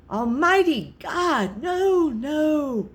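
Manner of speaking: high, desperate pleading; anguished cry. A narrator's reading. Madman crying out as the heartbeat grows, anguished. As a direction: cry out as the dread breaks. Narrator's despair at the beating.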